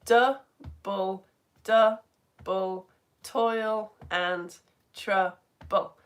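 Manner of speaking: slow demonstration of the rhythm; counting the beats. Shown as a demonstration. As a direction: chop it into separate, evenly spaced syllables. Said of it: "count and to dum at the same time".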